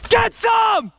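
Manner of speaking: high, loud hyped shout; battle yell. Real, not acted. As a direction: yell it as you open fire. Firing at enemies.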